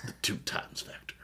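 dramatic whispered tagline. Acted. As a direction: breathe it like a secret. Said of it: "I'm gonna say that a lot now".

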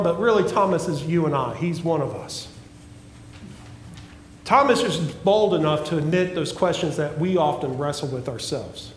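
usual speaking voice

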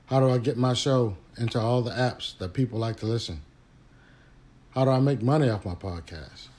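podcast ad read. Acted. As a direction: sell it easy and friendly, like a host-read spot.

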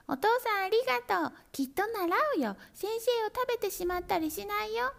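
high, fast chorus of happy kittens; eager kittens. A narrator's reading. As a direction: thank father all at once, bubbling. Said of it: "口々に".

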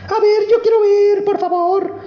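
very high, eager little princess. Acted. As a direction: squeal up high like a small girl begging. Princess begging.

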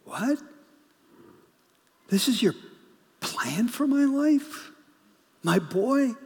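high, pained cry of disbelief; anguished disbelief. Retold or imitated. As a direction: plead with heaven as a grieving parent. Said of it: "scratching their head going".

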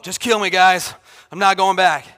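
loud melodramatic refusal; mock martyr, belting it out; Jonah. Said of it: "the most melodramatic dude of all scripture".

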